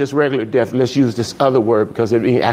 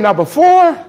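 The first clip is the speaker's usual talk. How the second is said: mock threat; 7 semitones higher than usual. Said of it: "I said".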